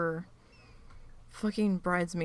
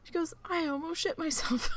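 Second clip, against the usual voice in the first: retold fright; 9 semitones higher than usual. Sister retelling the possum scare.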